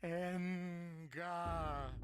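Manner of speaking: loud high wail of the name; anguished cry. Acted. Cries the city name.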